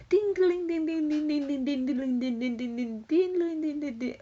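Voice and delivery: high, sing-song mock chiming of a game sound; ding it out like a bouncing Nintendo game; imitating Nintendo dings. Streamer describing a funny video, mimicking game dings. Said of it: "going like".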